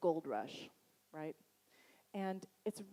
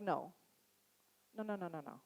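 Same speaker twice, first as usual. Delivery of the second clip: put-on Boston accent; 2 semitones higher than usual. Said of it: "we have this, like, strong Boston accent".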